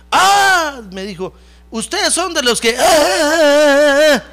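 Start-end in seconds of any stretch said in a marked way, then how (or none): 0.1-0.8 loud, high mock "ah"; mocking exclamation. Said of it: "qué burladores esos". 1.7-4.2 high jeering, ending in loud wails; mocking imitation. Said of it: "qué burladores esos".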